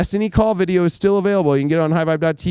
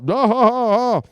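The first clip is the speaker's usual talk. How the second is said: mock evil laugh in Bane's voice; villain laugh; 5 semitones higher than usual. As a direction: cackle like a movie villain. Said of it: "like Bane in Batman".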